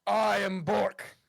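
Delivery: slow, heavy strongman voice; strongman Bork. Acted. Strongman Bork booming to the musician. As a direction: play the gentle giant, slow and weighty.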